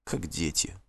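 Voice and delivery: very soft, low aside; вздох про себя. A narrator's reading. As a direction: sigh it as if to yourself. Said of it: "вздохнул он как бы про себя". (тихо, как бы про себя)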